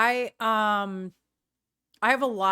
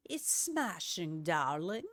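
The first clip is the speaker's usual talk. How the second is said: quiet, slow posh British "It's smashing, darling"; softer posh British accent; 4 semitones lower, 9 dB quieter, slower than usual. Shown as a demonstration. The vlogger repeats her joke line in a soft posh British accent. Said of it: "kind of an accent that I did".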